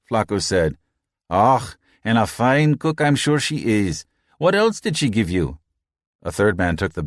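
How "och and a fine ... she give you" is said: Irish crewman. A narrator's reading. Red crewman mocks Flacco.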